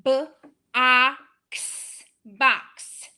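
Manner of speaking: each sound of "B-A-X" separated; teacher taps out a word; tapping out sounds. Shown as a demonstration. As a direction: segment the word, then blend. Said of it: "for each sound, I tap".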